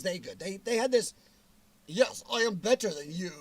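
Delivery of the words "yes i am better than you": a lightly put-on snooty British accent, looking down the nose; mock-posh British sneer, half-hearted. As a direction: play a stuck-up Brit who thinks he's better. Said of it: "I don't know what the accent, sorry to the British people".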